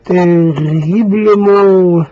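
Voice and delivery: very slow, drawn-out TERRIBLEMENT; savoured slowly. Real, not acted. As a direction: stretch every syllable and let it hang. Repeats the word, savouring it.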